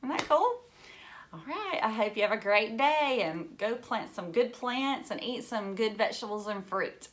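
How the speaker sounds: ordinary delivery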